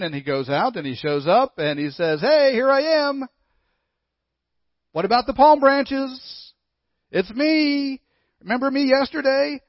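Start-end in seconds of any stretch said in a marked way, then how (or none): 2.2-9.6 high, loud, eager donkey voice; needy donkey. Acted. Vain donkey pleading with the marketplace crowd, high and loud. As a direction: beg for the crowd's notice.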